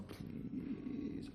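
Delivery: unvoiced, breathy please; whispered plea. Visiting monk begging softly at the gate in a whisper. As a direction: beg in a whisper.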